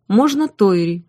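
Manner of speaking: usual speaking voice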